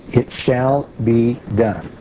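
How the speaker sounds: "shall be done" very slow, each word weighted; emphatic. Real, not acted. Preacher repeats the verse to the congregation, emphatic. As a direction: stretch out "shall be done" to drive it home. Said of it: "We can't emphasize that too much".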